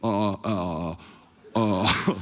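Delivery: low, dopey stalling noises; hesitant captain. Retold or imitated. Captain put on the spot, low hesitant grunting. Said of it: "it's how he sounds, not me doing anything".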